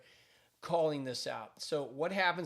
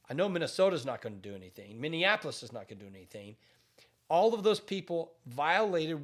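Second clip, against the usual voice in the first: ordinary delivery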